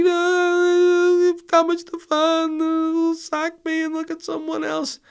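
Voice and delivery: very high, slow, whimpering mock sob; hammed-up tearful wail; imitating crying driver. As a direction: wail it out like a man blubbing on his knees. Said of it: "he was like".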